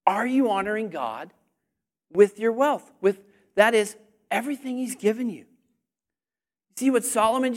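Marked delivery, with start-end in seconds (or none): none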